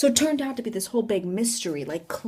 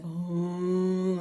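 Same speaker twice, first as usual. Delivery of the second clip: long drawn-out ghostly moan; imitated moaning; 2 semitones lower, 3 dB quieter than usual. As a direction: hold a slow moan on one note. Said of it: "like, moaning, like".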